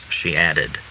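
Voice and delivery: ordinary delivery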